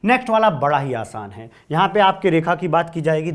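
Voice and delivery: ordinary delivery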